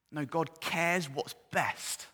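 ordinary talk, nothing marked

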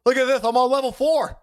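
put-on high bratty kid voice; whiny kid. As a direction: brush grandpa off like a kid. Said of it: "I'm like".

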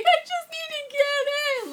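high, frantic panicked cry; freaking out. Retold or imitated. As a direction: beg to be let in, panicking. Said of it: "Like, I'm freaking out".

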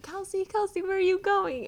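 high-pitched imitated calling-after; worried friend calling. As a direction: mimic a girl chasing you down. Girl running after her, calling out.

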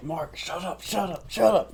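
high, urgent hushing through clenched teeth. Retold or imitated. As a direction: hush a friend while on the phone, teeth shut. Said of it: "talking through my teeth".

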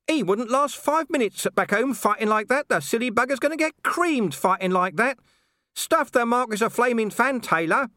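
high, cheeky dog voice, grumbling; a small dog scoffing at the fight; scruffy little talking dog. A narrator's reading. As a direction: Gaspode muttering scornfully. Small dog Gaspode talks.

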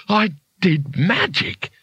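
slow, high-pitched giddy wonder; excited, power-drunk. Acted. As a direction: savour it, drunk on new power. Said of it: "he mumbled excitedly, drunk with power".

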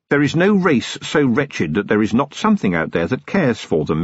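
usual speaking voice

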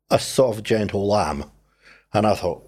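normal talking voice

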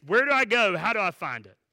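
loud, high burst easing off; eager questioner. Acted. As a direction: ask urgently, as if ready to go. Voices eager listener asking how.

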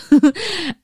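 bright burst of laughter; big laugh. Real, not acted. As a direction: laugh out loud at the joke. Laughs at own joke.

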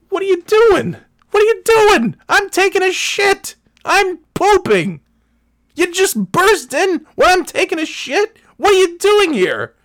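high-pitched, loud, hammed-up outrage; outraged yelling. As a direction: shriek at an intruder in comic disbelief. Acted yelling at the intruder.

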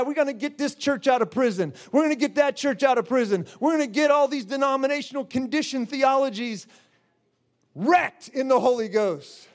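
usual speaking voice

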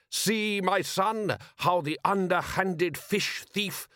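high, declaiming rival fisherman; foreign rival father. Acted. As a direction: point out the villain to your son, high-pitched. Rival father lecturing his son.

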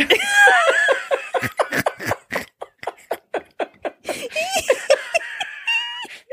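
high, screaming fit of laughter; helpless shrieking laugh. Real, not acted. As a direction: crack up and shriek with laughter at your own prank. Punchline of the prank story.

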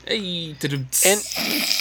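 soft mock drum rimshot; rimshot sound effect. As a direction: sing a little rimshot.

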